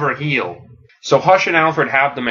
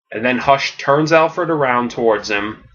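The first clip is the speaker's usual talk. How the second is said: ordinary delivery, nothing marked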